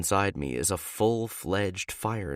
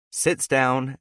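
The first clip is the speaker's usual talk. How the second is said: usual speaking voice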